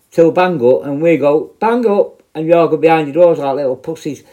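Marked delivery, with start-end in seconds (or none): 1.6-2.2 high, brisk lock-up call; acted call-out. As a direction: call out an order down the wing. Officers calling lock-up time.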